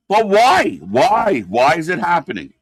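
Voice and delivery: exasperated why. Real, not acted. Fan questioning senseless booking, exasperated.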